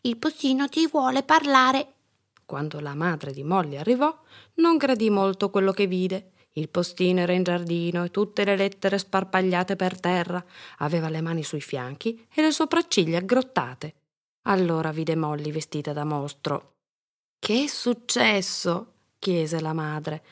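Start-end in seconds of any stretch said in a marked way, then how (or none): 0.0-1.9 put-on high, raised little-girl voice; child calling out. A narrator's reading. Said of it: "gridò". 17.4-19.0 high, slow question; questioning mother. A narrator's reading.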